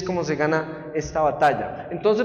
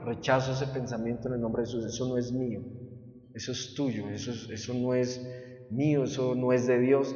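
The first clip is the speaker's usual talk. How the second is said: much softer and lower, under the breath; 7 semitones lower, 11 dB quieter than usual. Shown as a demonstration. Preacher shows the congregation how to rebuke thoughts, spoken low. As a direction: murmur the rebuke quietly so only you hear. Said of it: "Usted tiene que hacerlo en voz baja".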